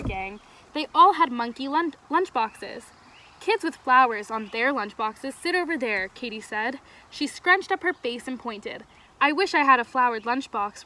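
clear, animated storybook reading; story read-aloud. A narrator's reading. Camp leader reading a story to kids, animated storytelling. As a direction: read expressively to a group of children.

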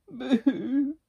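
high, loud little beaver wail; mock boo-hoo crying. A narrator's reading. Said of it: "he began to cry".

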